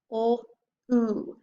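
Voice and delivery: ordinary talk, nothing marked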